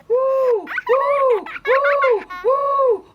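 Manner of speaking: high, loud startled woo; shrieking owl hoots; owl hoot laugh. Acted. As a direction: hoot each woo like an owl bitten on the bottom. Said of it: "like an owl who had been bitten on the bottom".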